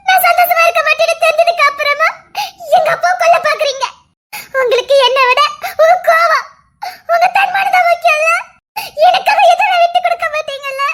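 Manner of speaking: sobbing, breaking reproach; tearful reproach. Acted. Daughter reproaching her father, sobbing and shouting. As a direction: cry it out to him.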